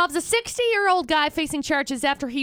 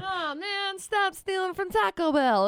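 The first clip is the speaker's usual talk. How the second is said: higher cartoon fox whine; Swiper voice; 6 semitones higher, 3 dB quieter than usual. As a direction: moan it like a foiled cartoon thief. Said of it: "Next time we'll get you, Swiper".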